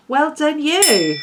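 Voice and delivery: drawn-out praise. Real, not acted. Praising Teresa warmly.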